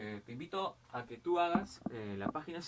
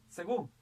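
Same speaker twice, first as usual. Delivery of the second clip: "Según" louder, with force on final "gún"; stress on the last syllable; 3 semitones higher, 5 dB louder than usual. Shown as a demonstration. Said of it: "la mayor fuerza de voz está acá".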